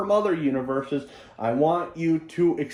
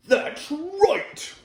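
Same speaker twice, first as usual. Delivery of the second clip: much higher, put-on cartoon villain cry; imitating a dubbed voice; 13 semitones higher than usual. Reviewer mimicking the voice given to Leafback, high imitation. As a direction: mimic an absurd dub voice, pitched way up. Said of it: "that's the voice the guy gave him".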